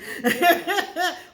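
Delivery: high burst of laughter; loud laugh. Real, not acted. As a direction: break into a big laugh. Laughs mid-sentence.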